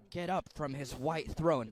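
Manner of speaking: ordinary talk, nothing marked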